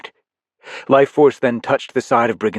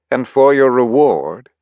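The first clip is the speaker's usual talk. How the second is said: smug villain; 5 semitones higher, 8 dB louder, slower than usual. Acted. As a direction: sneer the congratulations. Life Force stabs Briggon.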